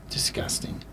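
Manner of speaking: very soft, unvoiced aside; hushed mock disgust. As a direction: drop to a near-whisper in disgust. Aside after odor questions.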